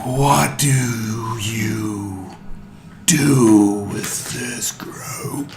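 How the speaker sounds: very slow alien question. Acted. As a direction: drag each word out ominously. Alien master interrogates.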